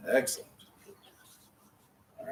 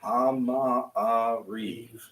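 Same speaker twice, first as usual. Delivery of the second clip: "Hama Ariv" drawn out slowly, sound by sound; slow syllable by syllable; much slower than usual. Shown as a demonstration. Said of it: "Can you say it quick?".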